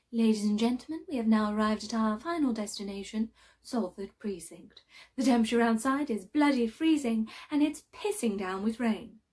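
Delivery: loud, projected flight attendant announcement; mock cabin announcement. Mock airline arrival announcement on bus.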